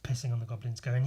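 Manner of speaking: ordinary talk, nothing marked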